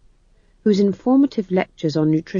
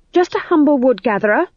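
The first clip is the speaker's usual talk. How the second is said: higher, louder testy retort; snapped; 8 semitones higher, 5 dB louder than usual. A narrator's reading. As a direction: snap it back at the travellers. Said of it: "she snapped".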